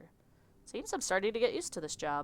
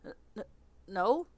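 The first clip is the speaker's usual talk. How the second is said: stammering Southern; 3 semitones higher than usual. Acted. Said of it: "I don't know why he's Southern".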